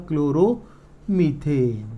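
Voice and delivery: very slow, stretched syllables; slow dictation. Shown as a demonstration. Teacher writing the first example's name, slow dictation. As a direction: draw the name out as you write it.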